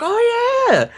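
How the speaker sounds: high-pitched fake enthusiasm; imitated eager agreement; mock recognition. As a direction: gush as if pretending to know the place. Mimics people pretending to know.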